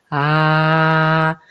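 low calm "Ah" felt in the chest; heart ah. Shown as a demonstration. Voice coach guiding a low chest "Ah". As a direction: sound "Ah" low with a hand on the heart. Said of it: "ponerse la mano y de sentir".

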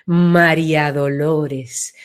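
low, slow, wide María Dolores; broadened name. Shown as a demonstration. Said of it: "se ensancha".

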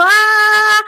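grito agudo y fuerte de "ah"; suéltalo agudo y alto, sin corazón. Shown as a demonstration. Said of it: "esa A no sirve para nada". ("¡Ah!" chillón y fuerte, mal ejemplo)

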